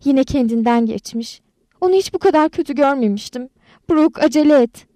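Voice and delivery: high, urgent panicked voice. A narrator's reading. Crystal waking Brooke over a collapsed friend, panicked. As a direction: raise the alarm, building to a loud plea. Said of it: "Crystal panik içinde karşımda duruyordu".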